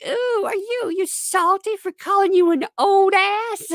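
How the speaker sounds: very high mock taunting voice; mocking detractors. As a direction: imitate a taunting critic way up high. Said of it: "they're like".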